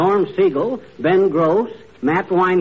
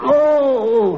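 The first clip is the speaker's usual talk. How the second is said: long, high comic moan of pain; pained groan; 8 semitones higher, 3 dB louder than usual. Acted. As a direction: groan as the sore rib is moved. Hurt rib, being moved on pillow.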